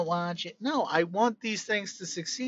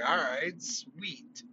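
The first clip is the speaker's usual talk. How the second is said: softer, slow, laid-back okay; casual fan reaction; 3 semitones lower, 7 dB quieter, much slower than usual. Retold or imitated. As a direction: shrug it off, slow and easy. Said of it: "You'd be like".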